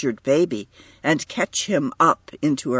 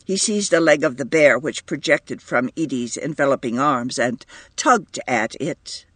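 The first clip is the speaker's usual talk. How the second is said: ordinary delivery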